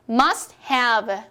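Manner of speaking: "must have" as "mas hav", silent T; T-dropped drill. Shown as a demonstration. Teacher leads two repetitions aloud, T dropped. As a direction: model "must have" in two clear beats without T. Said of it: "소리 크게 내어볼게요".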